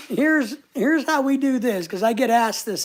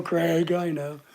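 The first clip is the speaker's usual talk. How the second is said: ordinary talk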